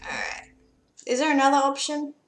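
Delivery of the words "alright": whispered aside. Real, not acted. Quiet aside while playing.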